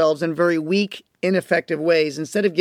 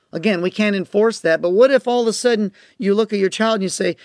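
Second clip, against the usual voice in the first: ordinary talk, nothing marked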